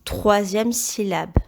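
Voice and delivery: ordinary delivery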